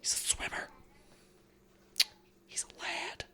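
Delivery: whispered aside. Real, not acted. Joking aside to his friend, whispered. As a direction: drop to a breathy whisper. Hushed aside about Aqualad.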